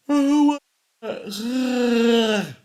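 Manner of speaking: words pulled out through a big yawn. Real, not acted. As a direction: say it mid-yawn, mouth wide open. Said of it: "Sorry for yawning like that".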